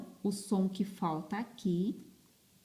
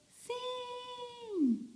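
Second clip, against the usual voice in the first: very high, soft little pupil voice; pupil answering; 6 dB quieter than usual. Acted. Teacher playing her pupils answering about the letter V, high pupil voice. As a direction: squeak out a drawn-out pupil's answer.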